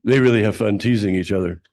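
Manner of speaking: speaking up after being asked. Real, not acted. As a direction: project it to the back of the room. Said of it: "Thank you".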